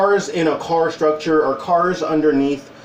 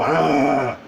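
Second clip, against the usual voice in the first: gross tongue noise; 2 semitones lower than usual. Retold or imitated. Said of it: "I go".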